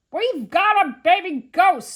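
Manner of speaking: very loud, high announcement; agitated shout. A narrator's reading. Museum man to the arriving policemen, loud agitated shout. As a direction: blurt it out at full volume.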